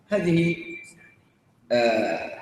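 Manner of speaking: ordinary talk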